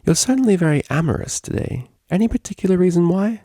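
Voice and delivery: high, coy question; flirty fluttery. Acted. Octavia flirtatiously probes her DJ, high and coy. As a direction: bat your lashes as you ask. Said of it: "fluttery eyelid looks".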